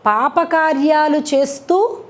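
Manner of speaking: usual speaking voice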